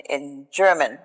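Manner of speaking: ordinary talk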